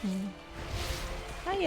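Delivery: ordinary delivery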